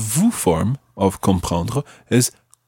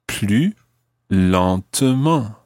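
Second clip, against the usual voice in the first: "plus", pause, then "lentement" drawn out syllable by syllable; slow model pronunciation; much slower than usual. Shown as a demonstration. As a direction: teach it slowly, "lentement" in separate syllables. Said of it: "And that would be".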